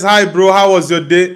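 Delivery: usual speaking voice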